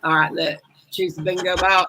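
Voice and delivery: ordinary talk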